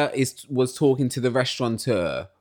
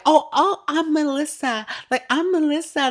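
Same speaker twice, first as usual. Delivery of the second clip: very high mocking simper; Melissa. Tattling on the sister-in-law, high mocking imitation. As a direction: put on a squeaky mimicking voice. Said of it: "she was there, like".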